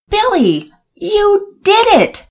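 high, slow, delighted cry; near shout of joy. Acted. Father cheering the neighbor who fixed the toilet, delighted near shout. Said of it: "Daddy Peters nearly shouted".

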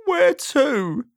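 high, squeaky, doleful question; woeful little squeaky query; Mister Miserable. A narrator's reading. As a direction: whine it in a sad squeak. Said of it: "asked Mister Miserable".

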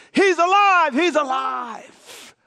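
loud, high cry then slow, drawn-out repeat; a preacher exulting in the resurrection; jubilant proclamation. Real, not acted. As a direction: proclaim it with rising joy. Sermon climax proclamation.

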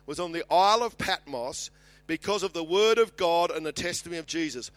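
high, loud, ringing Bible reading; Scripture proclamation. Acted. Preacher reads John's vision to the congregation, proclaiming loudly. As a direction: read it out boldly to the whole church.

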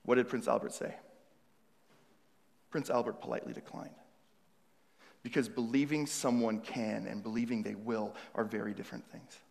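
ordinary talk

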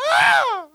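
high, loud mock terror scream; crying out. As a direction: scream as one of twelve panicking disciples. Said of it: "Imagine all twelve of 'em".

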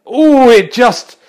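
loud, high, drawn-out "ooh"; slow-stretched cry of suspense; excited commentary. Pretend. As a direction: hang on the shot as it nearly drops. Commentator reacts to near pot.

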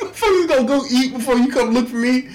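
laughing, crying complaint; outraged through tears of laughter; laughing outrage. Retold or imitated. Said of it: "I was like".

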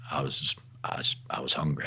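whispered, barely voiced confession; soft whisper of longing; hushed whisper. Real, not acted. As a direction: breathe it out like a private admission. Confessing spiritual hunger, whispered.

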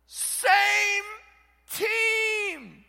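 high, loud shout; Paul yelling. Retold or imitated. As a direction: yell it to the divided church. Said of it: "This is Paul yelling".

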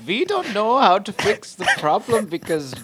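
put-on Indian accent. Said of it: "Why are you doing an Indian".

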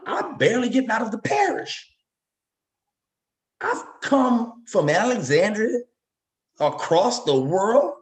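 from a shout of disbelief to slow triumph; rising excitement. Real, not acted. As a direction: build it up with pride. Building up his rise story.

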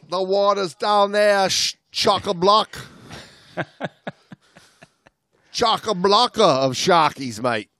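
goofy fake Aussie banter; mock Australian. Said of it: "our accents aren't gonna carry us".

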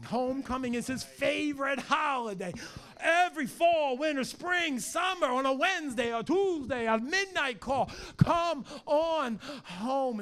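high, loud shouted preaching. Acted. Pastor shouting about homecoming to the church. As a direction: build it up and call out.

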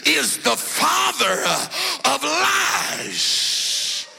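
rising, building preacher cry; preaching. Acted. Preaching rising to full force.

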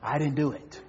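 low, soft wheedling excuse; lightly voiced inmate sweet-talking; pleading inmate. A hint of the prisoner flattering the chaplain. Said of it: "everyone's story say".

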